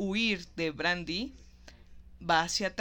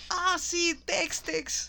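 higher, amused recognition; lightly mocking Cliff; 10 semitones higher, much slower than usual. Podcaster voices Cliff mocking Tex's name, amused. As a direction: savor the silly name.

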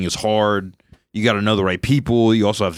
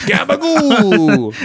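"Gabagool" stretched out slow and much higher in a dopey Italian voice; dopey Uncle Vinny; 13 semitones higher, 4 dB louder, much slower than usual. Acted. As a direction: drag out "Gabagool" like a clueless uncle. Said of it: "a mentally handicapped Uncle Vinny".

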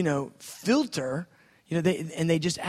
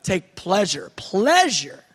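ecstatic; 6 semitones higher, 7 dB louder than usual. Shown as a demonstration. Acting God's delight, ecstatic.

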